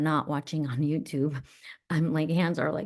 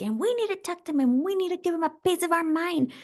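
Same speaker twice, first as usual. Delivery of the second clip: put-on higher, fast, riled-up teenage girl; angry teen self; 10 semitones higher, faster than usual. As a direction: push to go confront him, quick and heated. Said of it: "and she's like".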